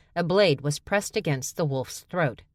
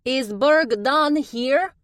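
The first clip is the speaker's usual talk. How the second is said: high, loud, slow calling; drawn-out high call; calling female voice; 10 semitones higher, 6 dB louder, much slower than usual. A narrator's reading. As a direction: call out over the heads. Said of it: "a female voice called out".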